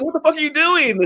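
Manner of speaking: high, panicked mock yell. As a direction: yelp it in a hammed-up panic. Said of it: "he's like".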